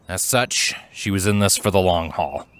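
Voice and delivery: ordinary delivery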